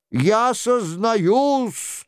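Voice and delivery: high, very drawn-out confession; imitating the king. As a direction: wail out a slow confession as the king. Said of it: "продолжал за Генриха Шико".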